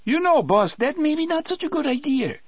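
high, fast, fussy Cajun voice; nervous and prissy. A narrator's reading. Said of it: "in a voice so nervous and prissy".

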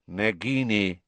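a hint of a cold master. A narrator's reading. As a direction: coldly address the snake. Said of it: "said the cold voice".

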